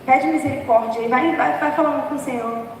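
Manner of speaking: usual speaking voice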